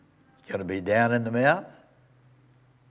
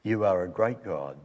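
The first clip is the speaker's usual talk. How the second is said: soft, hushed prayer voice, fading ever softer; closing prayer; 4 dB quieter than usual. Acted. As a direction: pray it gently and reverently.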